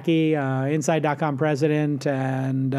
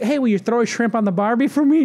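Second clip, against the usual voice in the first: high, louder mock Yank tourist voice; 7 semitones higher, 3 dB louder than usual. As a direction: tease a Kiwi with the wrong phrase, playfully high. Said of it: "Knowing that that's wrong".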